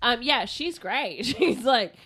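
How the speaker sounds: ordinary talk, nothing marked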